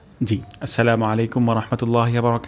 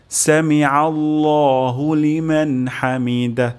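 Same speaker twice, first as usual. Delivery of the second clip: very slow, raised, each syllable held; slow recitation; 4 semitones higher, much slower than usual. Shown as a demonstration. Teacher reciting the full phrase slowly for copying. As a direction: chant it slowly and clearly. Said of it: "একটি গোল হা উচ্চারণ হবে".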